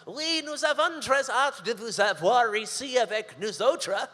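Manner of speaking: a hint of a boss's folksy French; Franco-Ontarian French. Boss answers in Franco-Ontarian French, lightly voiced. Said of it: "He goes".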